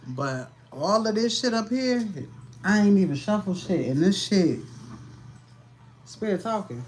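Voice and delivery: spirit talking. Pretend. Channelling spirit voice.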